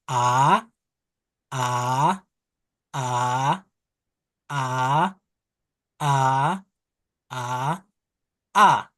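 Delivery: teacher reading "a" for the class; reading the vowel line. Shown as a demonstration. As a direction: open "a" repeated. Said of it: "I'm going to read, line number one".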